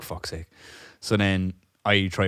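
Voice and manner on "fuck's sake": low muttered annoyed curse under the breath. Retold or imitated. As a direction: swear quietly to yourself, fed up. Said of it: "I'm like".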